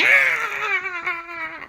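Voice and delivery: very high, long cartoon scream; Balthazar Brat. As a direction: shriek in mock terror. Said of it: "Balthazar Brat went".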